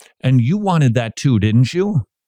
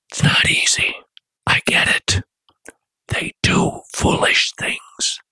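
whispering. Real, not acted. Host confiding to parents about letting kids go, whispered. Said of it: "I don't know why I'm whispering".

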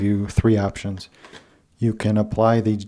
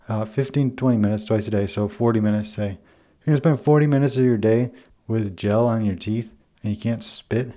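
ordinary talk

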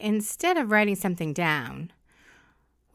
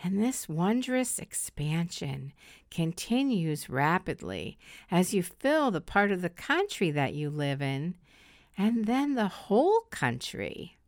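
slow, soft meditation voice; soft spa whisper; 4 dB quieter than usual. Acted. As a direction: guide them into calm, slowly. Leads a guided meditation.

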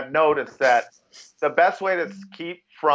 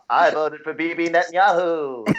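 [name] impression.

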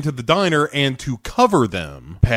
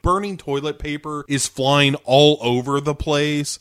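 ordinary delivery

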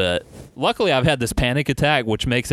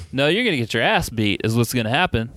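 ordinary talk, nothing marked

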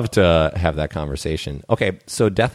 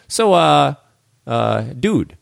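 put-on New York accent; 9 semitones higher, much slower than usual. Random New Yorker accosting him on the street, New York accent. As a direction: play a New York guy stopping a stranger, New York accent. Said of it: "I don't know why I'm talking like I'm from New York now".

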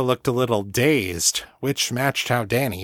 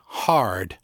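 usual speaking voice

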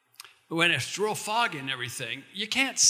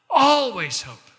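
always hope cried out higher and louder, slow; emphatic cry; 9 semitones higher, 8 dB louder, slower than usual. Real, not acted. Pastor crying out to a hopeless listener, loud and high. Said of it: "there is always hope".

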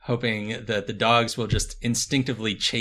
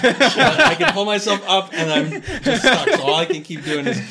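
laughing; 9 semitones higher, 7 dB louder than usual. Acted. Fat cat stuck in pipe.

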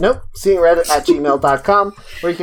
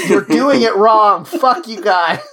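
mock yell; 6 semitones higher, 3 dB louder, slower than usual. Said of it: "be me yelling at everyone else".

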